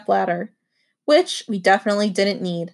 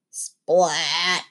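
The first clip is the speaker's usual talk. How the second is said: ordinary delivery, nothing marked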